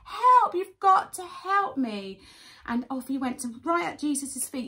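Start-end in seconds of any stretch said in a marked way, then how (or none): 0.0-2.2 high, loud begging cry; high, loud call, slowing on the last words; urgent plea. Retold or imitated. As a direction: plead like a panicked child. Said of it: "like my little girl said".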